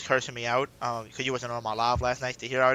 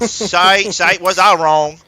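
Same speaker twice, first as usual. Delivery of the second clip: much louder and higher, drawn out; loud self-mocking exclamation; 6 semitones higher, 12 dB louder, much slower than usual. Real, not acted. Fan admitting his draft prediction flopped, loud self-mocking exclamation. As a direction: exclaim it big, owning the blunder.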